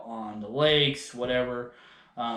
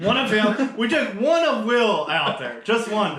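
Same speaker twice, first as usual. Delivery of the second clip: a lightly mocking higher echo of the slip; teasing echo; 7 semitones higher, 5 dB louder than usual. Ribbing the co-host over his slip, lightly mocking. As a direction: rib him, a bit higher and louder.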